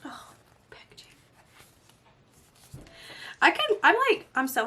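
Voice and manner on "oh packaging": soft breathy whisper over the packaging. Real, not acted. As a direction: breathe it, almost silent.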